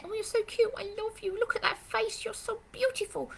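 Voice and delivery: put-on very high cooing baby talk; gushing visitor. Visitor gushing over a jumping puppy, high cooing. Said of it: "people will either say".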